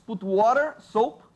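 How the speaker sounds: ordinary talk, nothing marked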